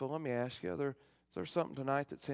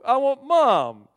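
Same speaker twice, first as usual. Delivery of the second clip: put-on much louder, high and slow wailing kid; drawn-out crying plea for mom; bawling child; 13 semitones higher, 16 dB louder, slower than usual. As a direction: wail it like a toddler in tears. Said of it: "just bawling".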